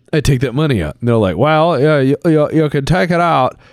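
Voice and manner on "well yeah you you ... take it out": lightly voiced stiff accountant; monotone accountant. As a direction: put on a dull accountant voice. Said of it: "that's my accountant voice".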